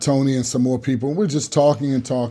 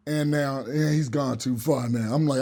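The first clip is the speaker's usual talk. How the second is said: normal talking voice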